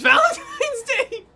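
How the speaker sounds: squealing with laughter; laughing. Real, not acted. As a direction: giggle through the words. Laughing while joking.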